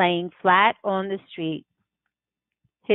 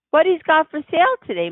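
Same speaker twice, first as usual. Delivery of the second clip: curious Mama; 9 semitones higher, 4 dB louder, faster than usual. Acted. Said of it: "Mama says".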